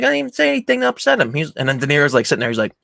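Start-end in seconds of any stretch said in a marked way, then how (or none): 0.0-1.3 high, quick mock protest; imitated self-defence. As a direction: play hurriedly pleading innocence. Acted protest of innocence.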